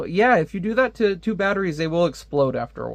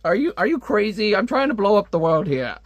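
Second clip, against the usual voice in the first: higher, incredulous villain; shocked disbelief; 5 semitones higher than usual. Retold or imitated. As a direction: pitch jumps up, as if she's lost her mind. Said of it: "He's like".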